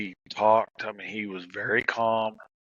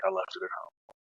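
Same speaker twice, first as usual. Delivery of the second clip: hushed calm reply; 3 semitones lower, 6 dB quieter than usual. Retold or imitated. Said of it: "He's like".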